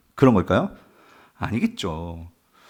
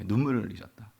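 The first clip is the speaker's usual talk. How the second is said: much softer, lower "눈물을"; hushed echo; 4 semitones lower, 11 dB quieter, faster than usual. Shown as a demonstration. Preacher repeating that Jesus shed tears, hushed and low on "눈물을". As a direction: let "눈물을" sink quietly.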